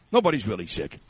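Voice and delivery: ordinary delivery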